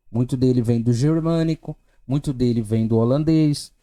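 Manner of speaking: ordinary talk, nothing marked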